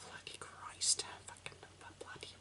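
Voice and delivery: unvoiced whispered cursing; whispering. Real, not acted. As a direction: hush the swearing to a breath. Whispered curses.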